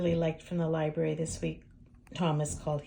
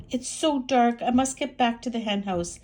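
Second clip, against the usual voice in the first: worried hen; 4 semitones higher, 6 dB louder than usual. Acted. Hen voiced in story.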